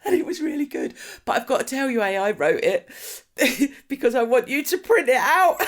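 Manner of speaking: high, laughing imitation of a caller; laughing confession. Retelling the daughter admitting AI wrote it, laughing. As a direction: push the retold words out through mounting laughter. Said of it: "She was laughing. She was like".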